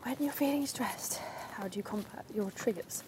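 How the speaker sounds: hushed whispered radio-presenter read; whispering like a Radio 4 presenter; Clare Balding impression. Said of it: "I'm doing my best Kerr Balding impression".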